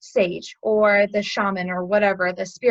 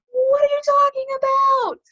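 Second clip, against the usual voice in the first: high, loud exasperated cry; acted indignation, pitch shooting way up; annoyed outburst; 6 dB louder than usual. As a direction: yell it in mock outrage. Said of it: "He's all annoyed at the sage".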